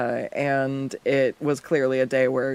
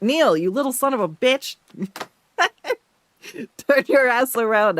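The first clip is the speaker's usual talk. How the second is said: higher, louder teasing scold at the drawing; playful scolding; 9 semitones higher, 4 dB louder than usual. Real, not acted. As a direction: scold him like a naughty pet, pitch up.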